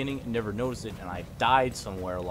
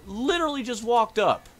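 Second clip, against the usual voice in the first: high startled yell; shocked gamer outrage; outraged shout; 14 semitones higher, 9 dB louder than usual. Real, not acted. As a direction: yell it, caught off guard the moment you arrive. Sudden ambush in game.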